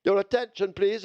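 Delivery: ordinary delivery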